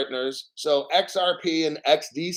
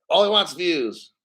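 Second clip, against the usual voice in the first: usual speaking voice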